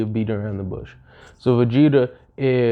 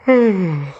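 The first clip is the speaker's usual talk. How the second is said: loud, higher exasperated sigh; heavy sigh; 7 semitones higher, 6 dB louder than usual. Acted. Gohan sighs loudly before scolding Vegeta. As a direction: sigh hard before the lecture.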